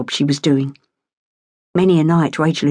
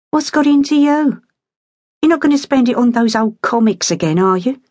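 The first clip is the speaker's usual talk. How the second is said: a hint of a wary mum; suspicious mother; 6 semitones higher than usual. A narrator's reading. Mother teasing her daughter about comics, wary.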